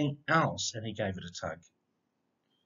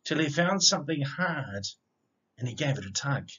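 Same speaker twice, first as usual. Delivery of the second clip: bouncy rhyming read-aloud, opening lines louder; picture book reading; 2 semitones lower, 6 dB louder than usual. A narrator's reading. Reading a rhyming dog story aloud to children, lively storyteller voice. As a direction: read it like a bedtime story for kids.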